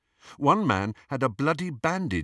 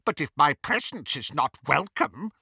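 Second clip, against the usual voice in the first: higher-voiced, stiffly offended courtesy; cool, affronted; 5 semitones higher than usual. A narrator's reading. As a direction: answer a snub with cool formality. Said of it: "said Travers coolly".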